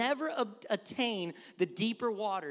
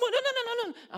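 high, loud string of panicked noes; hammed-up swimming-lesson panic; mock panicked child; 7 dB louder than usual. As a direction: yelp like a kid scared of the water. Child panicking while learning to swim.

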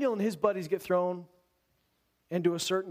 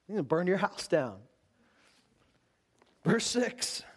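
usual speaking voice

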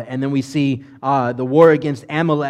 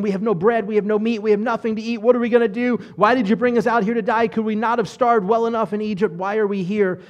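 higher-pitched, griping run of complaints; grumbling complaint; 7 semitones higher than usual. Acted. Hungry Israelites grumbling at being brought out to die, complaining. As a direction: whine and grumble like an aggrieved crowd. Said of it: "they begin to grumble and complain".

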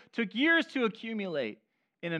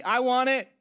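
louder, demanding call-and-response; entitled; 5 semitones higher, 6 dB louder than usual. Shown as a demonstration. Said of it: "Come on, say that with me".

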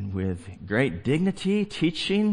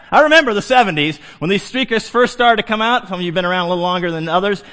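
ordinary delivery

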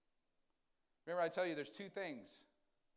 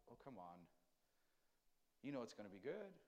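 soft, low, reassuring reply; gently insist, lower and softer; God coaxing a reluctant believer, soft and low; tender God's voice; 4 semitones lower, 9 dB quieter than usual. Acted. God coaxing in acted dialogue.

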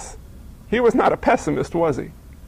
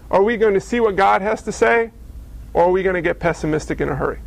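ordinary talk

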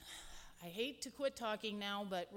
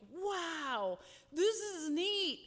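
imitating awed kids, much higher and louder, slow; amazed kids; 7 semitones higher, 4 dB louder, much slower than usual. Kids gasping at the new gym, slow and awed. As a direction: stretch out the wonder like a thrilled child. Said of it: "they were like".

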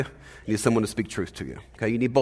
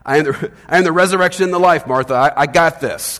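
higher, louder mock-confrontational claim; confronting; 5 semitones higher, 8 dB louder than usual. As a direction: confront her emphatically, imitating bold assurance. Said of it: "he's, he's kind of confronting".

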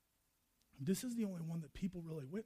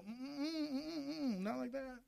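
high hummed objection; mock-squeamish, high-voiced balking; reluctant refusal; 6 semitones higher than usual. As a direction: hum a squirming no, pitched up. People balking at God's way.

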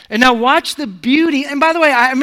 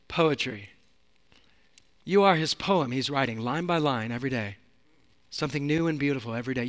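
low, soft and intimate, drawing them in close; a quiet, tender sermon voice; hushed preaching; 6 semitones lower, 9 dB quieter than usual. Acted. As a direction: lean in and speak it gently to each listener.

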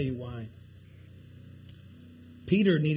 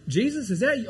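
higher, louder startled question; startled disciple; 6 semitones higher, 5 dB louder, faster than usual. Acted. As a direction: act out a startled double take. Acting out startled disciple seeing Jesus.

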